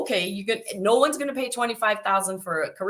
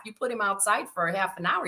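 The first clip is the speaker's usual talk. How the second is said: normal talking voice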